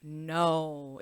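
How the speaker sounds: usual speaking voice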